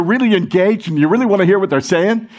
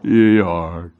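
much lower, droopy donkey drawl; Eeyore voice; 10 semitones lower than usual. Retold or imitated. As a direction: moan it like a gloomy donkey. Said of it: "you become a spiritual Eeyore".

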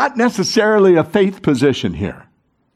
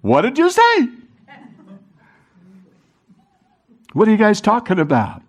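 high, playful mock puzzlement; Jesus butting in, hammed-up puzzled; curious Jesus; 4 semitones higher than usual. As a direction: butt in like a curious stranger. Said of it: "he goes".

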